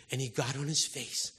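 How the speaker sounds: ordinary talk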